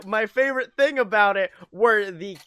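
normal talking voice

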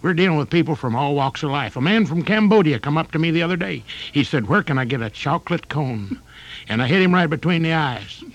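hammy country preacher voice; high folksy sermon voice. Acted.

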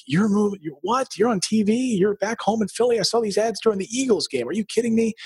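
put-on excited old friends. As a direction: play the childhood friends who can't believe it. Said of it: "from my childhood were like".

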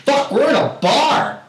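high, slow, drawn-out protest; retold upset outburst. Player protests bar rules to opponents, exasperated. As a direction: stretch it out in loud exasperation. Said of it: "I usually don't get so upset".

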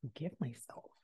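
very soft, low disbelief; stunned murmur. Retold or imitated. Student absorbing the professor's words, stunned low murmur. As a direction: barely voice it, low and hushed.

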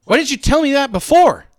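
high, loud mock frustration; retold exasperated yell, high and loud. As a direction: complain loudly at the corporal. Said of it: "And I was like".

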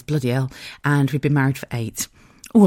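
normal talking voice

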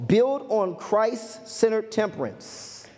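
slow, deliberate announcement of a point; unhurried, weighty delivery; slow, spaced-out emphasis. Real, not acted. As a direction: stretch it out phrase by phrase. Announces the point slowly, then repeats.

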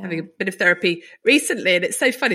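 usual speaking voice

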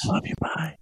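high airy whisper. Shown as a demonstration. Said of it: "I'm gonna whisper".

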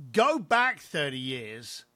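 usual speaking voice

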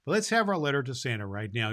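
ordinary talk

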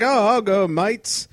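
bad Irish accent turning Australian; high, loud mock Irish-Australian boss; botched Irish accent. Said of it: "I don't know why he turned Australian".

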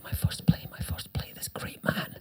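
hushed, reverent whisper to himself; awed whisper. Retold or imitated. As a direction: whisper it in awe of the great man. His awed thoughts entering room.

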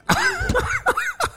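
squeaky high laugh. Real, not acted. Speaker laughing at his own rant, high squeak. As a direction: break into a high-pitched laugh.

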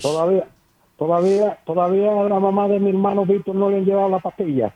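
loud and quick, half shouting; loud fast complaint. Real, not acted. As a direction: rattle it off loudly, like a public complaint. Caller complains loudly on air.

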